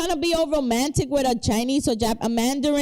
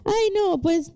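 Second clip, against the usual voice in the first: mock paisa Colombian accent, much higher and singsong; Colombian twang; 9 semitones higher than usual. Friend imitating Colombian women, in a high mock paisa Colombian accent. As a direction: lilt it like a Colombian paisa, pitched way up. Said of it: "with that little twang".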